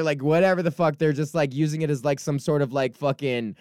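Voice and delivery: ordinary talk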